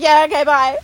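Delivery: acted-out quick getaway farewell; hasty goodbye. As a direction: get rid of him with a high, loud goodbye. Acting hasty goodbye to security guard.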